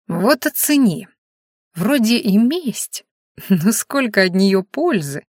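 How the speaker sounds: slow gloating sing-song. A narrator's reading. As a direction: savour it, singing smugly. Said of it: "пропел оборотень".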